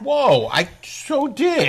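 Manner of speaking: high, drawn-out objection; mock-offended, dragging out the words; playful indignant protest. Real, not acted. Mock protest at partner's accusation.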